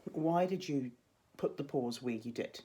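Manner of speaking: usual speaking voice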